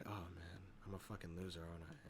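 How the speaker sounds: low, soft, mock-dejected mumble; mock sad, voice dropped low and small; retold sad. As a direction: imitate someone sinking into self-pity, quietly. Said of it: "starts going sad and everything".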